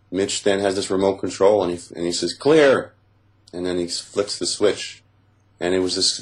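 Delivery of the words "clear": higher, louder call of clear. Retold or imitated. Captor warns before flipping the switch, a loud call. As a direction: sing out the word like a medic.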